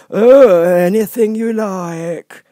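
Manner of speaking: high, slow, lofty footman voice; frog footman. Acted. Frog footman dismissing Alice at the door, lofty. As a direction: talk down to her from on high.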